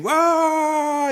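high mock scream of terror; imitated scream. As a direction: shriek like a startled bystander. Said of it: "someone starts screaming".

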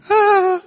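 high shrill mock scream, far above his voice; screaming at a spider falling on him; girlish scream. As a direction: shriek like a frightened girl. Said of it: "but I screamed".

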